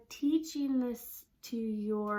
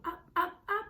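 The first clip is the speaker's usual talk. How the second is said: voice going up; 7 semitones higher than usual. Shown as a demonstration. Teacher showing how the voice climbs up. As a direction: pitch climbing higher on each up. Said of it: "you do it with your".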